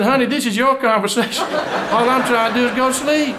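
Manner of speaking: high, protesting husband; hammed-up exasperation. Husband fed up with wife's questions.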